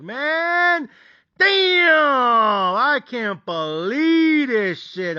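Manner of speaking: high, drawn-out cry of disbelief; frustrated disbelief. Retold or imitated. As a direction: stretch it out like a disgusted fan. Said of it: "That shit was frustrating".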